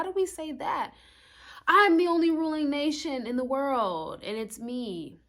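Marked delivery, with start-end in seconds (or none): 1.7-5.2 boastful tyrant. Acted. Tyrant nation quoted.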